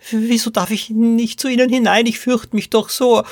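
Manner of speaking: put-on high, pleading little-girl voice; Poppy. A narrator's reading. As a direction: keep pestering like a scared small child. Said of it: "bohrte Poppy weiter". (hohes, bettelndes Kleinmädchenstimmchen)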